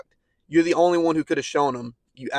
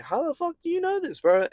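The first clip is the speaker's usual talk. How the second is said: shocked disbelief. Retold or imitated. Shocked at his mom knowing.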